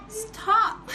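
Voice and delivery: 笑着说的停; laughing stop. Retold or imitated. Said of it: "I was like".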